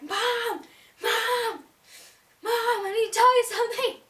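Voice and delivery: high loud call across the park; cupped-hands yell; calling out to mom. Retold or imitated. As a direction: shout for mom over the crowd.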